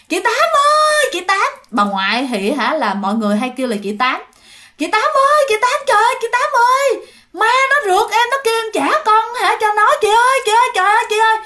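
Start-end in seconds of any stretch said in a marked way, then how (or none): 0.1-1.5 very high, loud distant call; calling out from afar. Acted. Young wife calling Tám from far away. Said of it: "nghe kêu". 4.8-11.4 high, loud terrified cries; panicked screaming. Acted. Terrified young wife running to Tám for help.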